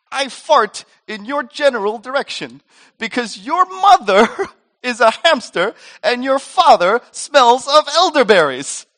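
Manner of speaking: high, loud, hammed-up taunt; ridiculous childish insult. Sibling outdoing the other in name-calling, loud hammed-up taunt. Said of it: "saying all kinds of ridiculous things".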